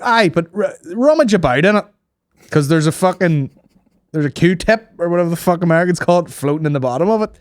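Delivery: high, loud, worked-up insistence; mock exasperated patient pleading his case; insistent caller. Acted patient insisting to doctor on phone.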